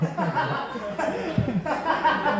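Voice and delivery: long, high burst of laughter; high laughing fit. Real, not acted. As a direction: crack up helplessly. Laughing at dad's lie.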